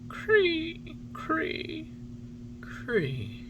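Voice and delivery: high, fading cricket chirps; weak dying chirp. A narrator's reading. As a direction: let the chirps die away. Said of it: "a last very weak".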